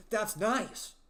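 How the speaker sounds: loud, high-pitched mock excitement; exaggerated enthusiasm. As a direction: overdo the delight, belting it out so nobody hears sarcasm. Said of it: "what do you want me to say?".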